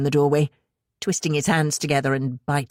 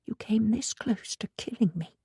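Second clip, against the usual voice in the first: breathy whisper; whispered accusation; 5 semitones higher, 7 dB quieter than usual. A narrator's reading. Anna accusing mother in a whisper. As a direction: hiss it under her breath. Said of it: "she said in a low voice".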